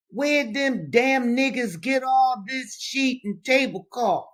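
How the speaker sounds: high, drawn-out cry of astonishment; surprised exclamation. A narrator's reading. Soldier finding grandmother's trunk full of linen, surprised exclamation. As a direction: exclaim it slowly, pitched well up. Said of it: "there was a great shout of surprise".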